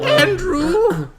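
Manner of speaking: high, drawn-out outraged call of the name; outraged cry. Real, not acted. Friend reacts to the story by crying out the name, high and outraged.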